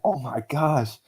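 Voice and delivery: retold excited outburst. Telling how he reacted to the trade alert, mildly excited. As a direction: replay your gasp of delight at big news. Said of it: "the same thing actually happened to me".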